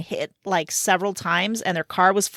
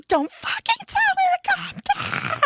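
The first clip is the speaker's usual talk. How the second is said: much higher, straining mock yell at the operator; retold furious yell. Mother retelling how she yelled at the 911 operator, mock furious yell. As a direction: fling it back at him, half-acted rage. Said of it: "I was screaming at the nine one one operator".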